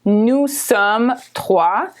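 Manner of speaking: "Nous sommes trois" said very slowly; slow textbook French. Shown as a demonstration. Teacher contrasting book French for learners, slow. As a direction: sound out the book phrase slowly. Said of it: "we learn the expression nous".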